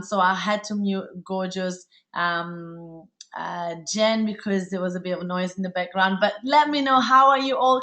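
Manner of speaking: ordinary talk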